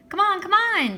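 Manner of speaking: high, loud impatient call; urgent calling. A narrator's reading. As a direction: shout up at him to hurry. Girl urging boy down tree.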